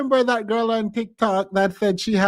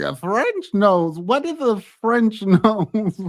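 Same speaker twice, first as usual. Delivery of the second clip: slow incredulous question; slower than usual. Retold or imitated. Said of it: "It's like".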